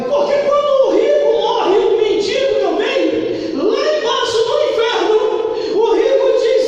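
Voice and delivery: high, loud preaching cry; fiery preaching. Acted. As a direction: preach it with full force, rising. Preaching at full force.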